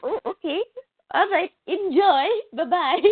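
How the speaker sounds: high-pitched imitation of a chirpy caller; sing-song mock phone goodbye; Lindsay. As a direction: sign off in a put-on high voice.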